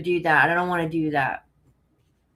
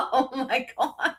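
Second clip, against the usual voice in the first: helpless laugh trailing into slow, higher words; real laughing; 4 semitones higher, 5 dB louder than usual. Real, not acted. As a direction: burst out laughing, then gasp the words. Cracks up at a chat comment.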